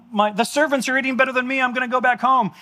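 hammed-up regretful son, high and fast; voiced prodigal son. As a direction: play the broke son talking himself home. Prodigal son's realization voiced.

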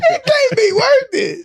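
high, slow laughing words. Real, not acted. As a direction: squeal it through laughter. Teasing, laughing hard.

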